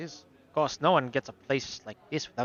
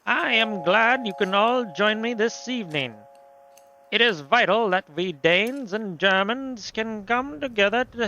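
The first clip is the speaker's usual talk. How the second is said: put-on higher-pitched, grandiose accented host; speechify in a theatrical European accent; 7 semitones higher than usual. Host addressing Danes and Germans at dinner, pompous accent. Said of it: "I'm giving him that accent".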